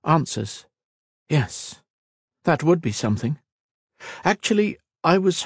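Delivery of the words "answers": musing echo. A narrator's reading. Kira mulling Kat's demand, musing echo. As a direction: turn the word over mildly.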